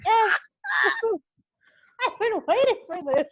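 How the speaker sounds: very high, loud, laughing; excited outburst. Retold or imitated. Reliving hearing hometown talk after being away, thrilled. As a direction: squeal it through laughter.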